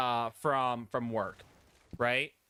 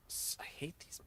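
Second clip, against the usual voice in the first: breathy whisper to himself; whispered aside; 4 semitones higher, 14 dB quieter than usual. Real, not acted. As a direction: mutter it under your breath. Muttered aside.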